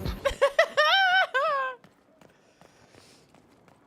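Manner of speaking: high burst of laughter; loud burst of laughter. Real, not acted. Laughs at game line.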